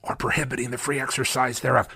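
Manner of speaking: mock hushed aside about the clause; almost whisper. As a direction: drop the voice as if hiding the clause. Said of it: "And they almost whisper".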